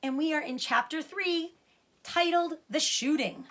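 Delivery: bright storybook reading voice; story reading. A narrator's reading. Reader opens the chapter for listening children, lively and bright. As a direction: announce the chapter to young listeners.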